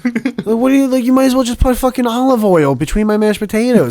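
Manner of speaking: high-pitched comic indignation; worked-up complaint, half in jest; mock mad. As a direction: rant in exasperated disbelief, pitch up. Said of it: "I'm mad now".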